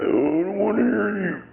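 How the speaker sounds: high whiny crybaby imitation; mock a crying complainer. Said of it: "he starts crying".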